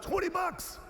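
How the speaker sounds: a high mock shout of a bid; yell. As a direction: call out your bid across the dock. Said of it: "yells out".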